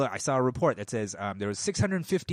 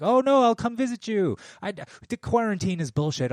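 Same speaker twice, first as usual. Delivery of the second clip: mock greedy grandchild; 9 semitones higher, 6 dB louder than usual. Greedy relatives voiced.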